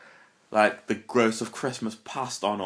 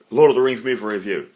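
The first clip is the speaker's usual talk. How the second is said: fast, loud announcement of the review title; quick title read; 7 dB louder, faster than usual. Acted. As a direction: rattle off the title briskly and loudly.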